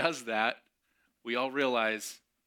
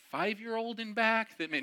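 mock jab; 10 semitones higher than usual. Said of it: "no, I didn't say that".

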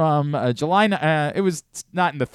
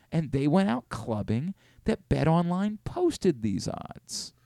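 soft, higher-pitched storyteller impression; Brian Windhorst; 5 semitones higher, 5 dB quieter than usual. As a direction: build a hushed mystery in a put-on high voice. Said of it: "Brian Windhorst right now".

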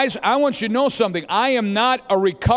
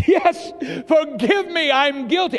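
higher-pitched, mock-penitent plea; pleading confession; 5 semitones higher than usual. Adam owning up to God, high pleading confession. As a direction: beg forgiveness in a hammed-up high voice.

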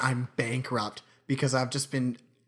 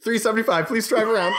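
high, loud intercom-style announcement; mock chirpy worker, pitched up; drive-through worker; 9 semitones higher, 5 dB louder than usual. Imitated drive-through worker, laughing.

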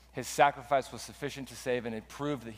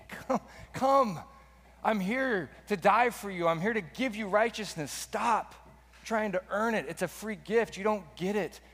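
pleading yell; 7 semitones higher than usual. Retold or imitated. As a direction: cry out to them, begging them to stop. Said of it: "Jesus is yelling at these guys".

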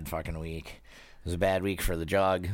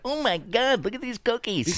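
much higher, squealing imitation of a thrilled friend; mock her delight over the cookies, way up high; gushing cookie fan. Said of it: "She's like".

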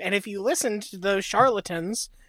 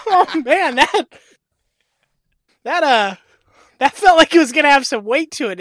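mock dramatic exclamation; 6 semitones higher, 9 dB louder than usual. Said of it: "I was like".

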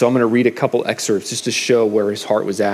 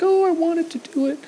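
put-on much higher, whining child voice; whiny kid. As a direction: whine like a kid told to do chores. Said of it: "they might cry like".

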